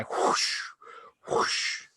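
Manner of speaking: voiceless rushing whoosh sound; breathy whoosh. Acted. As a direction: let the air rush past. Trees rushing past train.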